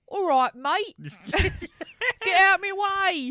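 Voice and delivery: loud, slow Cockney geezer with laugh; Cockney accent. Retold or imitated. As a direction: bark it like a London geezer. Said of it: "and they're like".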